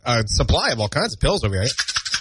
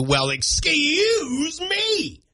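high, drawn-out comic "excuse me"; mock indignation; much slower than usual. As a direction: wail it out in hammed-up offence.

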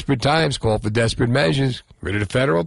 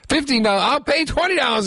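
high, mocking overbid; mock bidding; 13 semitones higher than usual. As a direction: parody someone outbidding for the cause. Mocking Colbert bidding up price.

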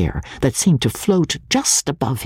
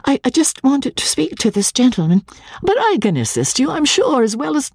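high, breathless stammering plea; nervous bride asking the clerk for help; timid young wife; 13 semitones higher, faster than usual. A narrator's reading. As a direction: stammer it out, half whispered. Magnolia's voice held.

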